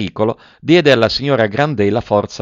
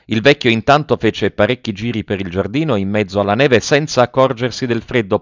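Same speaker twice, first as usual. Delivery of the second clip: ordinary delivery, nothing marked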